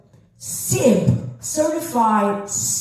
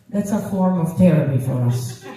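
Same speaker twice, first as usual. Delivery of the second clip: ordinary talk, nothing marked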